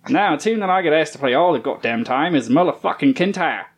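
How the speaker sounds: hammed-up Australian reading voice; Australian accent. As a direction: read it in a broad Australian accent. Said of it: "read this in your best Australian accent".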